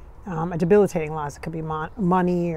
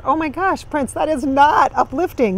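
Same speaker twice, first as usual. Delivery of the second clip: dismayed protest; 6 semitones higher, 7 dB louder than usual. Real, not acted. Reacting to grim advice.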